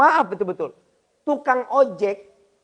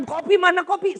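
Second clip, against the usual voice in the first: husband yelling; 10 semitones higher, 4 dB louder than usual. Retold or imitated. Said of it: "dia teriak-teriakan".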